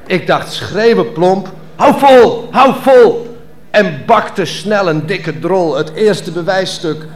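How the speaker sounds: performance poem. Acted. Poet performing his birth story on stage, declaiming. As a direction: perform the verse with mock drama.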